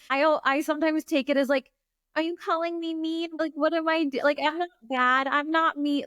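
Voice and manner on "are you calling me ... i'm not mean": high, defensive pleading; mock-wounded protest. Coach answering imagined accusers, high defensive protest. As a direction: plead innocence in a raised pitch. Acts out protest at being misjudged.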